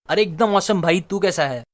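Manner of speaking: ordinary talk, nothing marked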